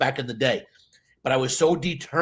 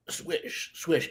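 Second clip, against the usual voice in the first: swish sound; 3 semitones higher, 7 dB quieter than usual. Shown as a demonstration. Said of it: "just this movement".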